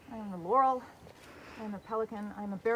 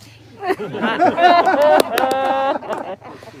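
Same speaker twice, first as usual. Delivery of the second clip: big delighted laugh, much louder; loud burst of laughter; 3 semitones higher, 13 dB louder than usual. Real, not acted. Speaker laughs at her own pun before the royals, loud burst of laughter.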